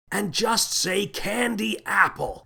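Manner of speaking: high, slow, stretched-out call; drawn-out distant shout. A narrator's reading. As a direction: call it out slowly as the voice drifts away. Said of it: "shouted the captain".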